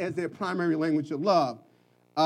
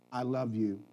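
soft I love you; 7 semitones lower, 10 dB quieter than usual. Shown as a demonstration. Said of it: "When's the last time you said to your mate".

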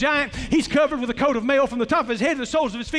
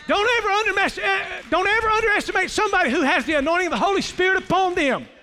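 higher and faster, preaching with fire; rising preaching fervor; 5 semitones higher, faster than usual. Real, not acted. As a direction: drive it home to the congregation. Preacher builds to climax.

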